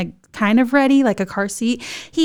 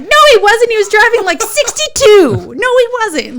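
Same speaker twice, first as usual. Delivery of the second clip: very high, loud outburst; mock-outraged high yell; indignant contradiction; 12 semitones higher, 8 dB louder than usual. Wife correcting husband's boast to dad, indignant.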